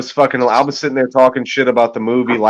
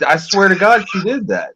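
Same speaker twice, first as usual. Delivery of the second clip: ordinary talk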